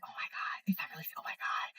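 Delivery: hushed gossiping whisper. Retold or imitated. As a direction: whisper like siblings spying from a balcony. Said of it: "I can hear them whispering".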